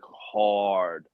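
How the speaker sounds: usual speaking voice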